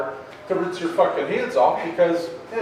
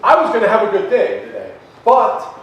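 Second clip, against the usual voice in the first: louder and higher, fed-up venting; frustrated outburst; 6 semitones higher, 6 dB louder than usual. Retold or imitated. Telling coworker she ruined his day, louder and higher. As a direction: let the frustration burst out.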